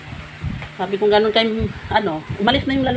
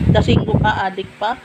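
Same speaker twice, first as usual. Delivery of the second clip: ordinary delivery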